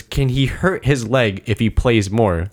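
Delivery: slow, deliberate retold question; slow repeated question. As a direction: spell it out slowly for someone who missed it. Question repeated slowly after "What?.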